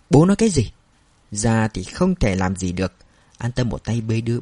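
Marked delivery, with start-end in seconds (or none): 0.1-0.7 higher, louder furious shout at father. A narrator's reading. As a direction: yell it at him in outrage. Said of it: "hét lên câu". (hét lên giận dữ với bố)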